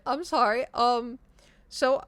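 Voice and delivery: choked up. Retold or imitated. Explaining the missed flight to the agent, choked up. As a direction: imitate fighting back tears while explaining. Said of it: "you get that lump in your throat".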